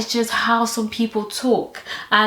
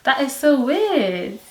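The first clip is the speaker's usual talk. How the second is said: ordinary talk, nothing marked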